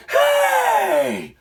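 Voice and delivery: high mock yell; retold scream. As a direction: belt out the warning. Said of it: "And so I screamed".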